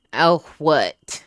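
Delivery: ordinary talk, nothing marked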